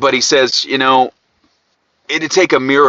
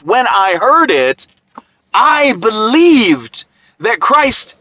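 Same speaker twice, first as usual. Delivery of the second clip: raised, urgent pulpit voice; fervent preaching; 7 semitones higher than usual. Acted. Preacher urging his listeners toward salvation, fervent. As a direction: preach it with full conviction.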